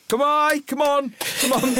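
high calls up the field; calling the sheep. Retold or imitated. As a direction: call the flock on, pitched high.

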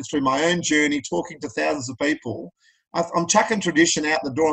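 ordinary talk, nothing marked

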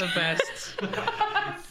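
high laughing echo. Real, not acted. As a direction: squeal it out through laughter. Echoing in a laughing falsetto.

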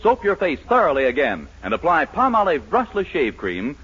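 ordinary delivery

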